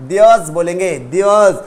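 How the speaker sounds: Theirs with a long vowel, no r, diəz; there's as theirs. Shown as a demonstration. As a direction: say Theirs, r silent. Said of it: "there is को short में क्या बोलेंगे". (Theirs, आर silent)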